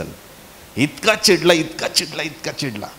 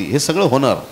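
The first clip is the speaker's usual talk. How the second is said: normal talking voice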